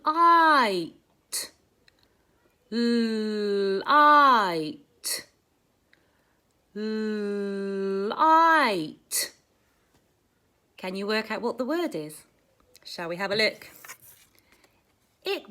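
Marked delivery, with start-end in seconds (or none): 0.0-9.3 Light. drawn out on the I; Light. with a long I vowel; stretched vowel. Shown as a demonstration. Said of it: "Sound it out".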